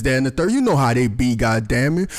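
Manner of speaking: usual speaking voice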